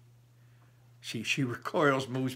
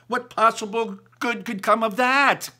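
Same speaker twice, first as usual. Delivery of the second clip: much higher and louder outcry; exclaiming; 11 semitones higher, 9 dB louder than usual. A narrator's reading. Mother outraged at her daughter, high and loud. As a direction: cry it out in disbelief. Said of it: "my mother exclaims".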